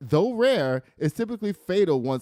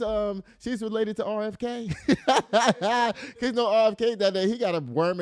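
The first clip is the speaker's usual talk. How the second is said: laughing; 8 semitones higher, 4 dB louder than usual. Real, not acted. Laughing mid-joke about RFK.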